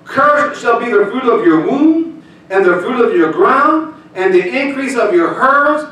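high, loud, slow proclaiming; preached scripture. Acted. Pastor reading curses to the church, loud and slow. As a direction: thunder the curses from the pulpit.

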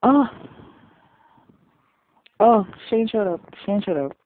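high, loud happy exclamation; surprised. Real, not acted. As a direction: gasp it out in delight. Surprised by character arriving.

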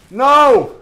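real gamer scream of despair; despairing shout. Real, not acted. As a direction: yell it as the game goes wrong. Dying in a hard game.

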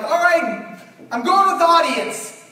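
loud, high, laughing declaration; excited. Retold or imitated. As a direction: announce the answer with full gusto. Committing to answer on stage.

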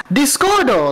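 lifted Japanese announcer cry; Japanese jingle voice. Retold or imitated. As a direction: imitate a Japanese sound logo. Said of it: "You're gonna just hear".

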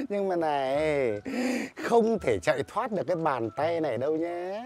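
ordinary talk

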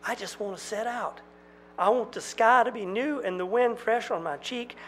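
high, pleading outcry; desperate outburst. Acted. Jack pleading with the twins, high desperate outcry. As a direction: pour out longing desperately.

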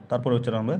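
normal talking voice

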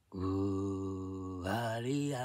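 drawn-out, very slow eerie wail; scary TV monster. Retold or imitated. Recalling a scary childhood TV show, a slow monster wail. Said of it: "とか言う".

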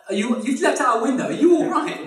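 high, loud, incredulous checking; mock alarmed concern. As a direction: play a baffled bystander fretting over the fallen. Old man alarmed after window fall.

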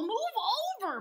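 high, loud bossy order; bossy cow. Acted. Cow orders animals to make room, loud and bossy. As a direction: push them aside with your voice. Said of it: "bossed the cow".

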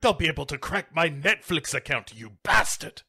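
high-pitched put-on indignant voice; hammed-up crime boss protest; outraged villain. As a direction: sputter at him in mock outrage. Lithgow protesting over radio, acted.

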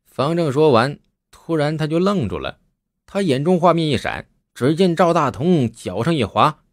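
ordinary delivery

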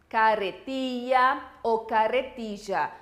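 "carretilla" com LL como "lh", depois com LL como "j", devagar; two LL pronunciations. Shown as a demonstration. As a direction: contraste os dois sons do LL em "carretilla". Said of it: "Doble L apresenta diferentes pronunciações". ("carretilla ou carretilla" mostrando lh e j)

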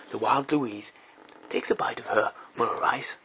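soft wildlife narrator voice; hushed documentary narration. Acted. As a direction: murmur it like a nature film.

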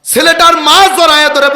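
high, loud preacher's chant of the story; full-force preaching. Acted. Preacher tells the crowd how the son wailed, full force. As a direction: drive the narration to the crowd.

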